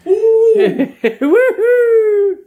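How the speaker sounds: high, loud celebratory cheer; triumphant woo-hoo after finishing the job; excited whoop. Real, not acted. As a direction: whoop it out for the camera.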